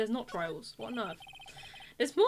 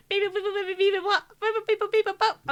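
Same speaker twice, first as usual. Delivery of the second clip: high beeping gibberish voice; chirpy talking animal babble; Animal Crossing voice; 8 semitones higher, 5 dB louder than usual. Acted. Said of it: "Animal Crossing voice".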